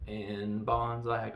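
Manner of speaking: usual speaking voice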